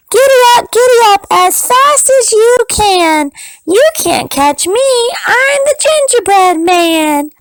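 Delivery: high, bold, teasing chant; high taunting cookie voice. Acted. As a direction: taunt them in a tiny high voice. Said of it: "and said".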